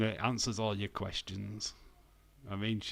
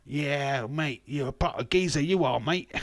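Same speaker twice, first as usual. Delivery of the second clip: louder, higher, broad London geezer; exaggerated London geezer impression; mock London accent; 4 semitones higher, 5 dB louder than usual. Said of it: "London's like this, mate".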